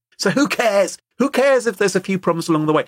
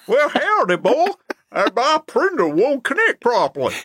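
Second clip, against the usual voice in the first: a hammed-up American accent, pitched much higher; 8 semitones higher than usual. Caller trying to get a US support bot to understand, in a hammed-up American accent. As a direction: put on a broad cowboy American accent to be understood. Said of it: "begin to talk in an American accent".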